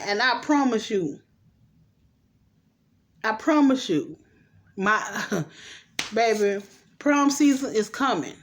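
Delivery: ordinary delivery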